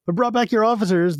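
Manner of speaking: a hint of harsh, blaring officer. A narrator's reading. Officer returning bodies to the knights, mildly harsh and blaring. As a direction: bark it a little, mildly harsh. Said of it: "his voice harsh and blaring".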